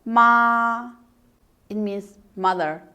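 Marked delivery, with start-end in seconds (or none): 0.0-0.9 "ma" long, level and loud; first tone. Shown as a demonstration. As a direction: hold "ma" out on an even pitch. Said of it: "It's the first tone".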